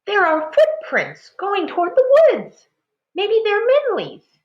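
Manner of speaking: high, loud hopeful call; eager. A narrator's reading. Mother finds Minli's footprints, high excited. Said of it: "Ma cried".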